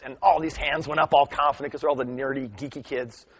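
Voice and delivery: normal talking voice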